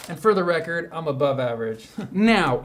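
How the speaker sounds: ordinary delivery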